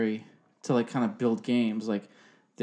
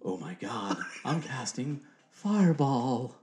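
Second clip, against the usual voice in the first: slow, drawn-out spellcasting voice; hammed-up wizard casting a spell; wizard character; slower than usual. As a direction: put on a campy wizard and stretch the spell. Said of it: "The wizard".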